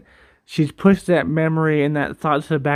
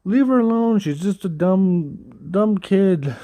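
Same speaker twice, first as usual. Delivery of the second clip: raised pitch, mild retold yell; pleading half-yell on someone's behalf, higher; mock yelling; 4 semitones higher, slower than usual. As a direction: imitate yelling out without full volume. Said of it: "Joel would yell out".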